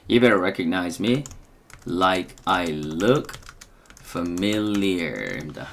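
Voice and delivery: very slow, every word of "You better recognize me like I look familiar" drawn out; very slow drill. Shown as a demonstration. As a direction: drag each word out for students to repeat. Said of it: "다시 한번 이걸 말해볼게요". (아주 느리게 한 단어씩 또박또박)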